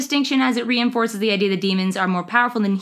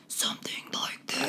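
eerie demon whisper; spooky voice; 13 dB quieter than usual. Shown as a demonstration. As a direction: breathe it out like a lurking ghost. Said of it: "A spooky voice".

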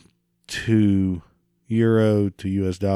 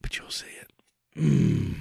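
whispering; 8 semitones lower, 8 dB quieter than usual. Real, not acted. Muttered aside.

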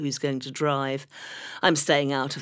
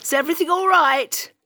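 very high, louder fake concern; prying concern; 12 semitones higher, 6 dB louder than usual. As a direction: gush out mock concern, high and loud. [name] asks, concerned.

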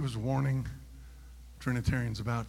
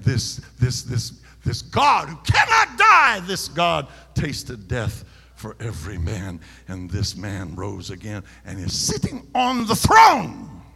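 slow, loud shouted preaching; preaching at full force; 10 dB louder, slower than usual. Acted. Preacher proclaiming to the church, slow shouting. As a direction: build it into a pulpit roar.

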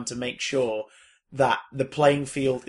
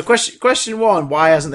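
mock formal answer; 3 semitones higher, 10 dB louder than usual.

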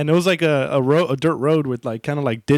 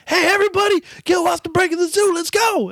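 much higher, rowdy rallying call; rallying drunk guy; 14 semitones higher, 4 dB louder than usual. Retold or imitated. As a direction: hype the crowd up high. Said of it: "And the guy's like".

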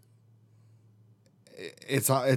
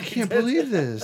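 mock disbelief; 12 semitones higher than usual. Fake dismay, high voice.

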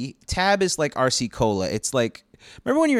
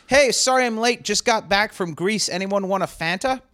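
breezy exec; 7 semitones higher than usual. Retold or imitated. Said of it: "he goes".

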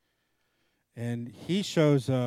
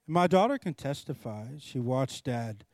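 ordinary delivery

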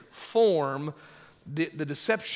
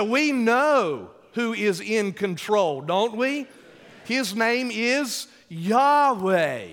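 higher and louder, stretched out slowly; louder, slower repeat; 6 semitones higher, 7 dB louder, slower than usual. Shown as a demonstration. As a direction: drive it home to win a bigger amen. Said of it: "I said".

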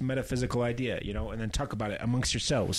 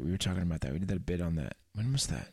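ordinary delivery

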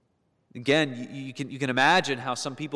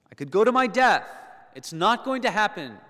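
much higher, louder, cocky certainty; brash boast; 8 semitones higher, 6 dB louder than usual. Retold or imitated. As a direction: brush off the warning boldly. Preacher paraphrasing Peter's boast.

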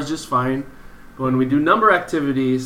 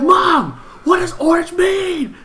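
high-pitched mock wail of an upset kid; squeaky, hammed-up child's plea; slower than usual. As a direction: imitate a kid crying out to mom in exasperation. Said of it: "he got so upset".

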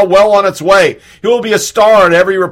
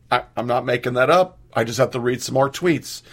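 quiet aside; 7 semitones lower, 10 dB quieter than usual. Real, not acted. Sudden drop to low aside.

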